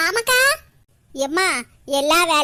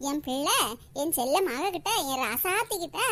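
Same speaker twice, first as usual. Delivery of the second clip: tender cooing; 5 semitones lower, 7 dB quieter than usual. Acted. Mother cooing over her daughter.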